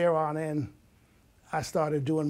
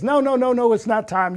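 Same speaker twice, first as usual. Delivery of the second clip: raised, higher insistent refusal; emphatic no; 6 semitones higher, 9 dB louder than usual. Retold or imitated. Professor telling student to stay, emphatic raised voice. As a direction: insist loudly, cutting him off.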